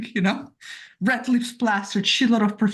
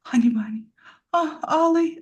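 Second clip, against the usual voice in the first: whispered pet name, then a higher coo; mock sexy voice; 6 semitones higher than usual. Replaying missed pet names for Crowley, mock-seductively. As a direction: gasp and whisper it, hammed-up seduction. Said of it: "I can't even do sexy face".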